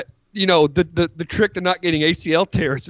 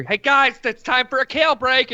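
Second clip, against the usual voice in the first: higher-pitched mock announcement; chirpy trainer calling out; 7 semitones higher than usual. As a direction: call the team over in a raised, eager voice. Acted trainer calling into huddle.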